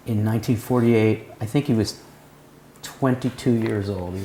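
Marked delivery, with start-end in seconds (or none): none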